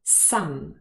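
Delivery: "Some" stressed, with its full vowel; stressed full form. Shown as a demonstration. Teacher showing learners the word in isolation, stressed full form. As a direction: give "Some" its strong, clear vowel. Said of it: "stress it in a sentence".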